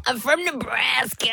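mocking American accent. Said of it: "She sounds so American".

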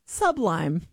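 Sublime said slowly as "SUB-lime"; two separate words, "sub lime"; friend's mispronunciation. Retold or imitated. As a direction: stress the first syllable, "SUB", in Sublime. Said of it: "My friend says".

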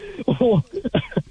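high burst of laughter; laughing hard. Real, not acted. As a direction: crack up laughing. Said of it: "I'm just dying laughing".

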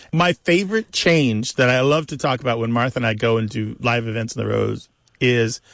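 normal talking voice

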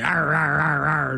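mock dog growling in repeated bursts; imitated dog growl. Owner showing how the dog gets rough, imitated growling. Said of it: "It's like".

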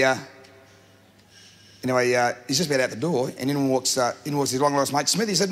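ordinary delivery

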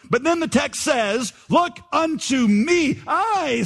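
high, drawn-out preacher in broad Essex; hammed-up Essex accent, stretched words. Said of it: "said he in a broad Essex accent".

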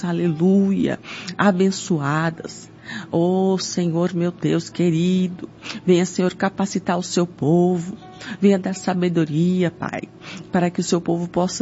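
usual speaking voice

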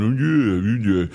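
put-on whiny grumble, running into gibberish muttering; hammed-up chorus of petty complainers chiming in; mock grumbling disciples. Disciples joining Judas's complaint about wasted money, mock grumbling. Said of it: "Judas starts to grumble, and the first thing you know, the other disciples are going".

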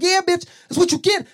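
high, loud hyped-up taunt. Retold or imitated. Man gloating at whoever slapped him, hyped up. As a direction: crow it loudly after landing a hit. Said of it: "I got hype".

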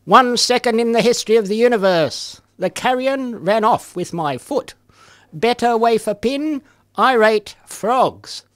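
performed poetry reading voice; poem reading. Acted. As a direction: read it like a poem.

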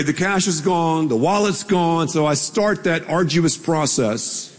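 usual speaking voice

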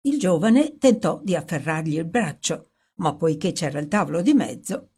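plain reading voice

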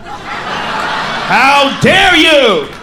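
drawn-out, indignant How dare you; hammed-up offended yell, very slow; mock outrage. Real, not acted. Comic scolding a heckler, stretched-out indignant How dare you.

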